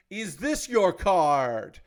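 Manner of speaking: very slow, showy magician reveal; magician's card trick line. Pretend. YouTuber adding a magician's reveal for effect, slow and showy. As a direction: ask it like a stage magician unveiling the trick. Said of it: "I added that for effect".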